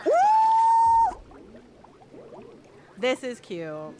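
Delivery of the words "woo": high, loud "woo"; imitated whoop. Player voicing the game woman's woo, whooping. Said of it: "She said".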